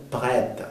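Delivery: "prête" with open eh; quiet French "prête", open vowel. Shown as a demonstration. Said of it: "sonido E abierto".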